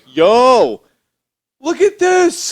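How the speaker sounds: loud, high-pitched cat brag; mock shout. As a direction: call out proudly as the cat. Acting out the cat showing off.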